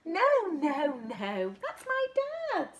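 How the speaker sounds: eager correcting; excited correction. Acted. Little monkey telling the butterfly no, that's a snake, high and excited.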